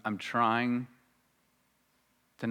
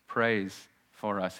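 slow solemn; 4 semitones lower, 3 dB quieter, much slower than usual. Real, not acted. Solemn emphasis on prayer.

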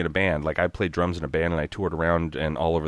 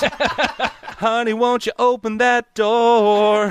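high, slow country singer voice; 4 dB louder, much slower than usual. Retold or imitated. Dad at the radio station mimicking a country singer. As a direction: stretch it out like a twangy singer. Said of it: "And now [name]".

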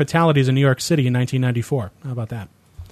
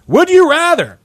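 high, drawn-up game-show call; game catchphrase; 13 semitones higher, 5 dB louder, slower than usual. Pretend. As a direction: sing out the question, high and loud. Game catchphrase called out each round.

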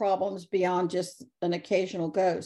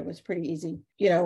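normal talking voice

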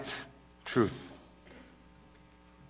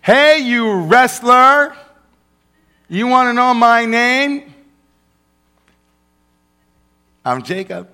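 high, loud swaggering wrestler voice; playful tough-guy voice; 10 semitones higher, 8 dB louder than usual. Acted. Playful character voice for Jacob.

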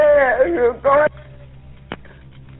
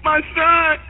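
normal talking voice

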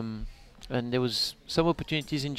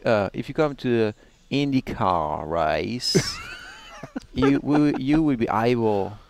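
slow, drawn-out mock ad read; slow impression of a teammate's ad line; Will Power impression; 3 semitones lower, slower than usual. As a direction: stretch every word like a slow promo. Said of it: "he speaks slow like that".

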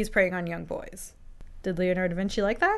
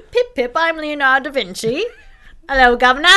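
louder, higher posh English voice; mock English accent; 6 semitones higher, 9 dB louder than usual. Da Vinci introducing himself in mock English accent. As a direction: play a jolly cartoon Englishman. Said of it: "he's very English".

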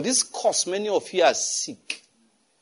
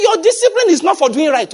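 emphatic quote; 12 semitones higher, 8 dB louder than usual. Retold or imitated. Pastor quoting emphatically.

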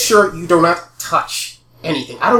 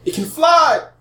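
high, thrilled exclaim; excited; 12 semitones higher, slower than usual. Acted. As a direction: burst out in delight. Villain delighted by flying scarab.

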